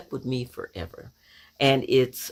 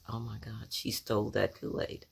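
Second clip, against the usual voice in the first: much softer, lower aside; hushed realization; 3 semitones lower, 10 dB quieter than usual. Retold or imitated. Sister realizing the Kool-Aid was stolen, hushed. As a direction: drop to a hushed inner thought. Retold shocked first thought.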